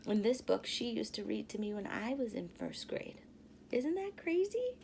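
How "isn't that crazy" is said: ordinary delivery, nothing marked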